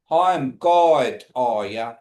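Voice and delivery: "Hi, I'm God" luider en traag uitgesproken. Shown as a demonstration. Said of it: "Y'all repeat that for me".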